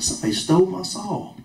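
realizing a theft, half-mock outrage; a touch of retold anger; mildly indignant. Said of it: "I was mad".

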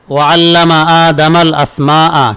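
slow, measured Quranic chant; slow flowing recitation of the verse. Shown as a demonstration. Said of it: "এটা কোরআনের আয়াত".

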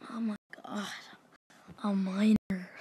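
soft, low disbelief; low, stunned and hushed; hushed awe. Real, not acted. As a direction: breathe it out quietly, awestruck. Awed at getting a Miner.

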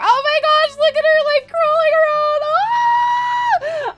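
high, loud delighted squeal; rising into an excited shriek; gushing excitement. Real, not acted. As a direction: squeal over something unbearably cute. Overwhelmed watching baby crawl.

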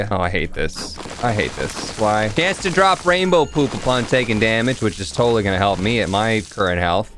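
ordinary talk, nothing marked

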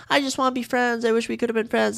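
high, mocking girly voice; mock contestants. Host mimics the women making peace, high mock-sweet voice. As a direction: imitate women making up, pitched well up. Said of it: "the women were like".

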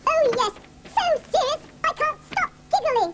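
high, soft puppet mouse; squeaky mouse voice. Acted. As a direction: play a tiny giggly mouse. Puppet mouse giggles at presenter.

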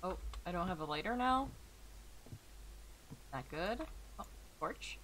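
ordinary delivery

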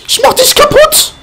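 shrill squeaky put-on voice; high cartoon threat. Player threatening an enemy in a high squeaky voice. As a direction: threaten like a tiny cartoon villain.

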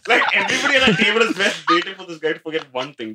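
high, fast words through laughter; laughing. Real, not acted. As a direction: laugh while you say it. Laughing while telling table story.

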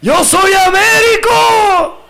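very high, slow, crying shout. Retold or imitated. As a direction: belt it like a weepy stage singer. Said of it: "me ponían él".

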